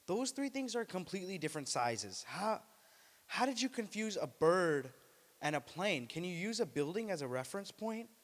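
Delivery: high, incredulous exasperation; exasperated Superman. Retold or imitated. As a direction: lose patience at the crowd. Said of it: "Superman was like".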